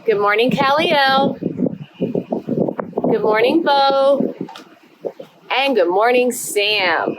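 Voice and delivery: bright sing-song roll call; kindergarten teacher voice. Acted. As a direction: greet each little one warmly. Greets each child by name.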